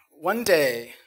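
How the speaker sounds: usual speaking voice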